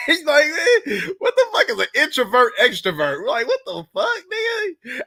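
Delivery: high, laughing outrage; laughing disbelief. Real, not acted. Host reacting to guest's story, high laughing disbelief. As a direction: crack up while asking.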